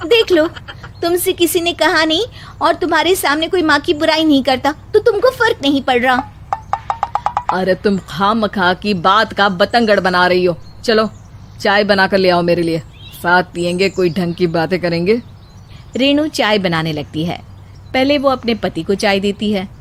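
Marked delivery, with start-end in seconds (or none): 0.1-6.3 put-on higher young bride voice, patiently scolding; higher daughter-in-law warning of ridicule; daughter-in-law, reasoning. Wife argues with husband. 7.5-15.2 a bit lower, quick, dismissive; lightly voiced husband.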